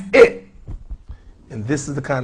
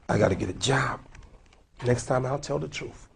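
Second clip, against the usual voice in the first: usual speaking voice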